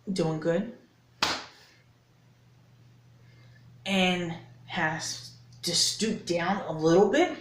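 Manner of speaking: measured, slow and pointed with long pauses; slow, deliberate lecture. Shown as a demonstration. Lecturing Howard with gestures, slow and deliberate. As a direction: spell it out slowly like a lesson. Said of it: "I'm gonna say it like this".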